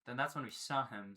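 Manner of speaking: ordinary delivery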